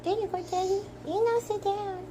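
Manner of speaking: bossy little dog voice; high dog voice. Pretend. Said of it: "She said".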